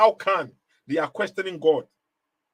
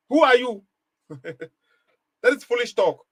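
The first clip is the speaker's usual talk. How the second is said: indignant challenge; 6 semitones higher than usual. Real, not acted. Rhetorical challenge to doubters.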